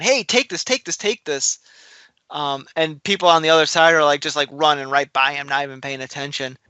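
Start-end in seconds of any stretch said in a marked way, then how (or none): 0.0-1.6 higher, urgent mock call. Imitating a man handing off the stolen plans, urgent calling out. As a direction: act out a hurried plea to grab it. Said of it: "call out for someone".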